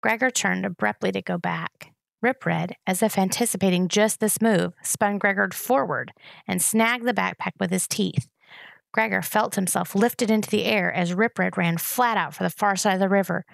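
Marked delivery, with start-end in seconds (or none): none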